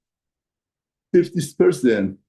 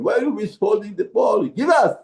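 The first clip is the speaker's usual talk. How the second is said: high, pleading yell for the ball; imitating players yelling for the ball; crowd screaming; 10 semitones higher than usual. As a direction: call out like angry teammates. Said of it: "Everybody is screaming".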